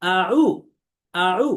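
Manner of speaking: আউ with the ain from the middle of the throat; correct demo. Shown as a demonstration. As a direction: model আউ slowly and clearly. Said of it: "তাহলে দেখেন".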